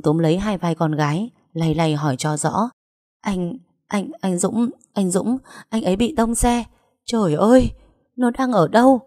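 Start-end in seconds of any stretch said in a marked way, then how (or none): 3.2-6.6 higher, halting, upset voice; shocked daughter, words catching; distraught stammering. A narrator's reading. Daughter stammers out news of crash. 7.1-9.0 high, raised cry of a frantic mother; high-pitched narrated yell; distraught scream. A narrator's reading. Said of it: "Bà Phượng hét lên".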